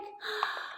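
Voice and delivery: surprised gasp. Acted. Egg opened, frog revealed.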